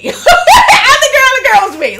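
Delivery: laughing hard, loud and high. Real, not acted. The teller admitting to viewers she was the girl, laughing hard. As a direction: crack up admitting it. Laughing confession after telling story.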